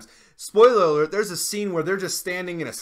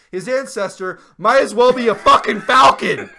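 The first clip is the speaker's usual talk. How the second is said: shouting through a laugh, much higher; 8 semitones higher, 11 dB louder than usual. Real, not acted. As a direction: yell it, cracking up. Laughing outrage at bird.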